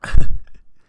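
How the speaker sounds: big breathy laugh; cracking up at the joke; loud laugh. Real, not acted. Laughing at own bit.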